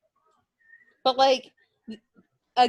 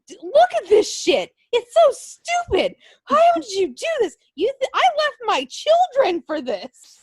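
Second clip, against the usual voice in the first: much higher, louder, shrill-free scolding in a put-on woman's voice; mock outraged woman; 12 semitones higher, 4 dB louder than usual. Maria scolds the wrestler for dancing, hammed-up high indignation. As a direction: play the indignant wife tearing into him, pitched way up. Maria berating him, acted quarrel.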